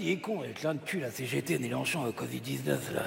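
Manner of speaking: low, soft monotone grumble; grumbling aside. Acted. Puppet complaining about the partner to himself, grumbling low. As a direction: grouse to yourself in a low litany.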